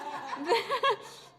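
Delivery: high, loud burst of laughter. Real, not acted. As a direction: laugh at herself. Laughs at the big ring.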